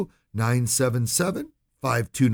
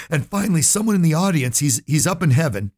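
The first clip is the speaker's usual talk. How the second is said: ordinary delivery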